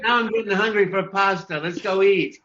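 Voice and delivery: playful Mario voice. Pretend. Voice actor joking after the catchphrase, playful Mario.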